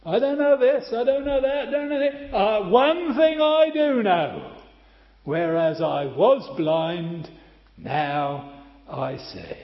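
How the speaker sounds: loud, high, fervent proclamation; blind man's testimony. Acted. Preacher voicing the healed blind man, loud and fervent. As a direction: cry it out like a man who can suddenly see. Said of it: "what was it the blind man who was healed said?".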